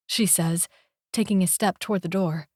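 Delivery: ordinary delivery, nothing marked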